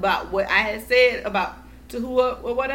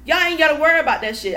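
retold angry tirade, raised and loud; going off on them; 5 semitones higher, 6 dB louder than usual. Retelling blowup at aunts about accused son, going off. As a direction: rattle off your outrage at the relatives. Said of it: "I went off on".

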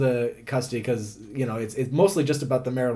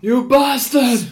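slow, high, loud shouted quote; hammed-up shout from a film; movie scream; 9 semitones higher, 6 dB louder, much slower than usual.

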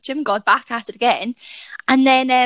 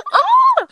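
loud high shriek of laughter; high laughing yelp; 6 dB louder than usual. Real, not acted. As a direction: let out a real squeal of embarrassed laughter. Embarrassed gym story, laughing.

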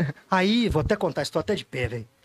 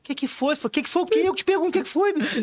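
confused wife; 7 semitones higher than usual. Retold or imitated. Wife confused after waking.

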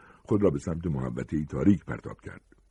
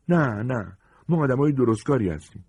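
high-pitched protest; indignant refusal; 7 semitones higher, 4 dB louder than usual. Acted. Woman turning down a fugitive's bribe, indignant refusal.

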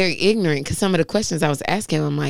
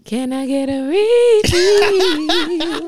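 high, slow, sing-song ask; drawn-out cheeky plea for more; playful request; 11 semitones higher, 4 dB louder, much slower than usual. Real, not acted. Asking the bartender for more.

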